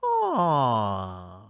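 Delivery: low, cooing mock aww. The world cooing over the reset, mock aww. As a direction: coo at it like a cute puppy. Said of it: "The rest of the world goes".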